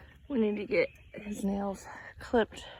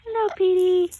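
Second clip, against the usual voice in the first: high sing-song hello; 9 semitones higher, 7 dB louder than usual. Real, not acted. Prompting the parrot to talk.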